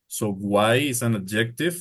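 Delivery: ordinary talk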